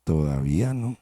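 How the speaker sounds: low, soft, gentle refusal; smiling master. Acted. Smiling master gently refuses the cup, low and soft. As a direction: gently say not yet with a smile. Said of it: "mi maestro me sonreía".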